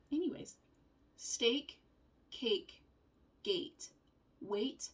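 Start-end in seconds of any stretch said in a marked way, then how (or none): none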